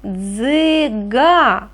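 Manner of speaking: Дзига slowly split into дзи-га, both vowels held; sounded out slowly. Shown as a demonstration. Teacher sounding out Дзига for the next sound, slow дзи-га. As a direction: stretch Дзига syllable by syllable to bring out the Г. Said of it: "Наступне".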